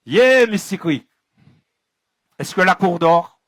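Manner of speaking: loud rallying call. Real, not acted. Storyteller asks the audience if they're asleep, loud call. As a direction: wake the crowd up with it.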